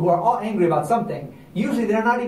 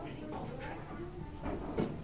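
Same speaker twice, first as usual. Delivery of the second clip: slow, soft, barely voiced suspense; hushed suspense; 10 semitones higher, 18 dB quieter, slower than usual. A narrator's reading. Storyteller hushing children before the gunshot, slow and soft. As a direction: draw the kids in with a hush.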